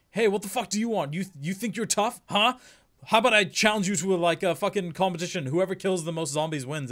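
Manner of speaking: loud, cocky challenger; tough guy. Retold or imitated. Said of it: "trying to be this fucking tough guy".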